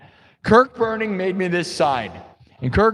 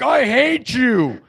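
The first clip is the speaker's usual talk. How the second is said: high, stretched-out mock yell; mock hateful yell; 6 semitones higher, much slower than usual. Passerby heckling a farmers' market band, mock yell. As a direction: imitate a passerby yelling abuse. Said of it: "you need to yell at me".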